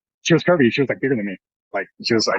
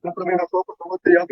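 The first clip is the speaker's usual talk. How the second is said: higher, coaxing Spanish pickup line; higher mock woman's voice asking for a coke; imitating older woman; 9 semitones higher than usual. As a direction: mimic her wheedling ask for a drink.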